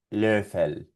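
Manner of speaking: "Löffel" with a rounded ö; German ö. Shown as a demonstration. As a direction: model "Löffel" clearly. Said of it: "con esta técnica".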